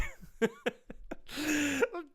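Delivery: long high helpless laugh; manic laughter. Real, not acted. Fan mocking the trolls, manic laughter. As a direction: crack up after the taunt. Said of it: "laughing manically".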